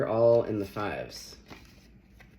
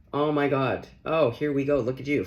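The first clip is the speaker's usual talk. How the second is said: louder, higher burst of real excitement; excited exclaim; 4 semitones higher, 7 dB louder than usual. Real, not acted. Reader reacting to a tarot card, excited exclaim. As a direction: react to the card like it's no surprise at all.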